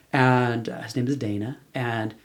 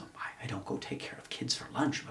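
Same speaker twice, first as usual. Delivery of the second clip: muttered thought; 11 dB quieter than usual. Retold or imitated. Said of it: "my first thought is".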